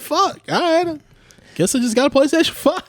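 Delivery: high, wry resigned voice; mock resignation. Accepting he just owns a console, high wry resignation. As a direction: shrug it off with a laugh.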